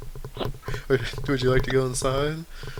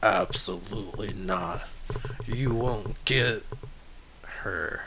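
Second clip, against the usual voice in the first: lower, slow, quiet threat; menacing reindeer; 3 semitones lower, 7 dB quieter than usual. Acted. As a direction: loom over them and rumble. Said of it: "He goes".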